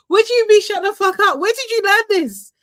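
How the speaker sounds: high, loud, laughing outrage; delighted disbelief. Real, not acted. Amused outrage at auntie swearing.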